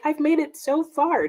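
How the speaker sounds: high, bright little boast; proud announcement. Retold or imitated. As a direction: announce it like a small victory. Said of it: "I was gonna be like".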